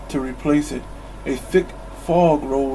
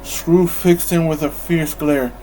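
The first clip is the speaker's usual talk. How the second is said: ordinary delivery, nothing marked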